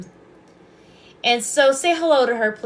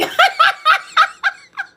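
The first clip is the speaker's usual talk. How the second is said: high, loud delighted laugh; a squealing real laugh; burst of laughter; 8 dB louder than usual. Real, not acted. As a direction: crack up at the comment. Reacting to viewer comment.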